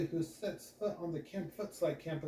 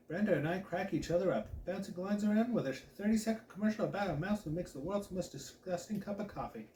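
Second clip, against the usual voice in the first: ordinary delivery, nothing marked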